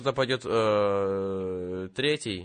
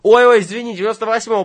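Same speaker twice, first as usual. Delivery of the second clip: much higher, louder flustered apology; startled oops; 11 semitones higher, 10 dB louder, much faster than usual. Acted. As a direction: catch yourself in a blunder. Realizes he called the wrong unit.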